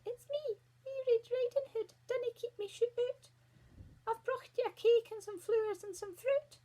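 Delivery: very high put-on girly squeak; wee squeaky voice. A narrator's reading. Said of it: "put on a wee squeaky voice".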